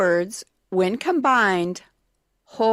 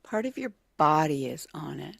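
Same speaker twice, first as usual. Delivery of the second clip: whispery, softer repeat of a clue; thinking aloud; 4 semitones lower, 6 dB quieter than usual. Real, not acted. Contestant puzzling over the clue, half-whispered. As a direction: whisper it to yourself while puzzling.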